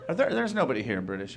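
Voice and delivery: ordinary delivery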